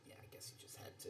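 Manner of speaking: fast, barely voiced mutter; whispered mumble. Real, not acted. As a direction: swallow the words in embarrassment. Trailing embarrassed aside.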